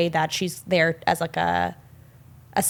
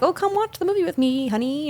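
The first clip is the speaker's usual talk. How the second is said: higher, coaxing mother voice; sweet mom; 8 semitones higher than usual. Retold or imitated. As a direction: imitate a mom being sweet to her son. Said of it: "sweet to him".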